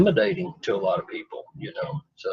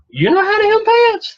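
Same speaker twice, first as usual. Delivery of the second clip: much higher and louder, voice squeaking up in disbelief; astonished squeal; 6 dB louder than usual. Retold or imitated. Roxana shrieks at Jeff in disbelief, high squeal. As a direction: squeal it like you can't believe a man can sew. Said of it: "And Roxana said".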